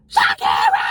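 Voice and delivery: low, loud called name; imitating a yell. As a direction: yell her name like the drunk friend did. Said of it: "this girl yelled at me just like that".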